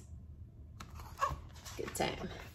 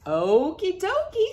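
higher, sing-song okie dokie; cheerful agreement; 10 semitones higher, 6 dB louder than usual. Acted. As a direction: chirp it happily. Hank agrees.

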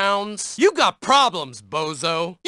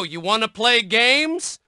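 normal talking voice

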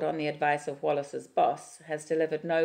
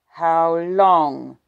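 demanding; 3 semitones higher, 14 dB louder than usual. Acted. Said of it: "she says".

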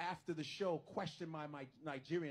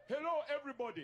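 put-on high, louder caricatured African accent; mock African accent; 8 semitones higher, 4 dB louder, faster than usual. Comic mocking what people expect of Africans, exaggerated African accent. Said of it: "They want us to be like".

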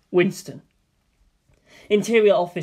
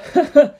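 short laugh; 8 semitones higher, 7 dB louder than usual. Acted. Coworker laughs at own joke.

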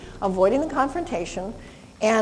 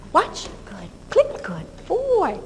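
high cue, slow praise; 6 semitones higher, slower than usual. Shown as a demonstration. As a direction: mark it and praise him. Rewarding dog in demo.

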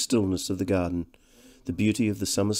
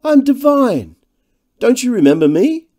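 high, loud introduction; effusive greeting; 10 dB louder than usual. A narrator's reading. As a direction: announce yourself brightly to an old schoolmate. Slender man introduces himself effusively.

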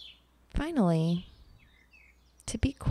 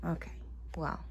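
soft resigned murmur; 4 semitones lower, 13 dB quieter, faster than usual. Real, not acted.

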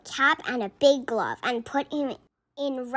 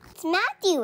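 yell; 7 semitones higher than usual. A narrator's reading. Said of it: "everyone yelled".

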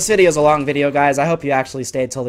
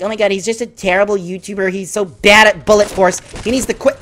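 lightly voiced scornful viewer, a bit higher; mock viewer voice; 4 semitones higher, 4 dB louder than usual. A player mocks himself as a jeering viewer, lightly voiced. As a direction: sneer as a mild jeering viewer. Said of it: "Everybody watching this is like".

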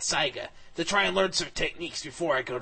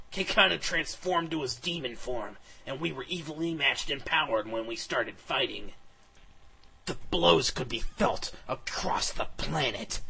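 ordinary delivery

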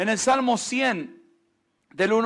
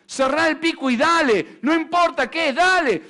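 louder, higher shouted orders; harsh overseer; 5 semitones higher, 5 dB louder than usual. Retold or imitated. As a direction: shout them on like a cruel taskmaster. Voices the harsh slave driver.

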